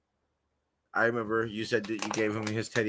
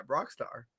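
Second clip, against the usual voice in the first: ordinary delivery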